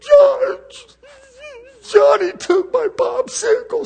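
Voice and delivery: put-on high whining kid's voice, shrieking the name; whiny child. Tattling child whining loudly to a parent about a popsicle. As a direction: whine like a little kid tattling at full pitch. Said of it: "same little kid coming".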